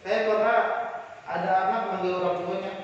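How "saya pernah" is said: normal talking voice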